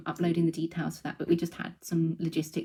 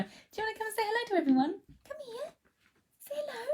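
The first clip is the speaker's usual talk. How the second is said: pet talk; 4 dB quieter, faster than usual. Real, not acted. Host urging her cat to greet viewers, high soft baby talk. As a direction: sweet-talk the cat toward the camera, pitched way up. Coaxing the cat onto camera.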